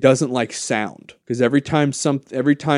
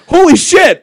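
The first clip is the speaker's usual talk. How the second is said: loud, high shout of disbelief; mock-astonished yell at a twist; amazed outburst; 14 dB louder than usual. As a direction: burst out stunned and thrilled. Reacting to the episode's creepy ending.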